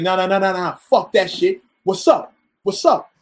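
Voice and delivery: imitated aggressive taunting, rising in pitch; super aggressive. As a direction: act out a friend picking a fight. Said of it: "He started talking to me super aggressive".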